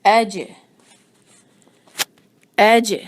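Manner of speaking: ایج with a sharp jolt on ج; jolted jeem. Shown as a demonstration. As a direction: give ایج a clear bounce. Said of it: "اس پر تھوڑا جھٹکا دینا ہے". (ایج جھٹکے کے ساتھ)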